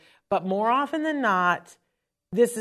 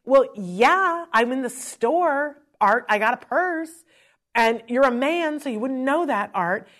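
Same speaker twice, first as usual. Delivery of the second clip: higher, louder, indignant; retelling snapping at a calm expert, indignant and raised; retold indignant snap; 6 semitones higher, 4 dB louder than usual.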